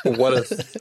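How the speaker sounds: normal talking voice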